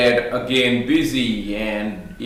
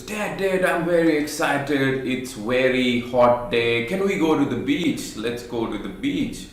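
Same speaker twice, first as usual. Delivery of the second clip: put-on excited kid; 3 semitones higher than usual. Son begging dad to go to the beach, excited. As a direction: beg like a thrilled little boy.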